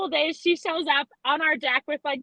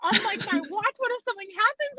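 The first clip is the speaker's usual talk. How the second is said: mock panic; 8 semitones higher than usual. Retelling her own panic.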